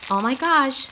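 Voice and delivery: ordinary delivery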